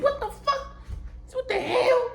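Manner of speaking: high-pitched startled cry of surprise; shocked yelp. Retold or imitated. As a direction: squeal it like you just got jumped. Retold shocked reaction.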